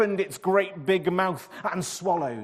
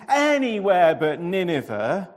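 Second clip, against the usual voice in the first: groaning; 5 semitones higher, 9 dB louder than usual. A narrator's reading. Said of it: "he groaned".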